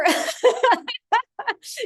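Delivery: high bright laugh; a real delighted laugh. Real, not acted. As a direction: laugh off the emotion. Emotional finale talk.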